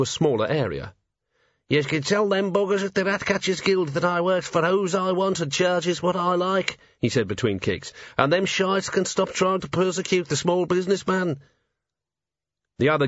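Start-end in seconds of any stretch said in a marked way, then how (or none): 1.7-6.8 high-pitched, aggrieved little fighter; high voice with a rough dialect, spitting defiance; belligerent Wee Mad Arthur. A narrator's reading. Tiny fighter warning the guild between kicks, belligerent. Angry small tough character's quoted speech. 8.2-11.4 high-pitched, aggrieved little fighter; high voice with a rough dialect, spitting defiance; belligerent Wee Mad Arthur. A narrator's reading. Tiny fighter warning the guild between kicks, belligerent.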